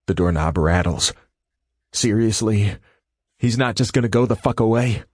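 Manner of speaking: ordinary delivery, nothing marked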